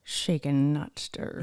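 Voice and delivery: low, hushed Scottish spy; amateur suave spy impression; Sean Connery. Shown as a demonstration. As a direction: slur the S in a low voice. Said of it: "How does he like his martinis?".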